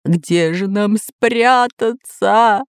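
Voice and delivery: slow, whining wail; hammed-up fearful lament, stretched out; crying from fear. A narrator's reading. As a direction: drag it out like a frightened sob. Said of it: "плакал от страха Бульбуль".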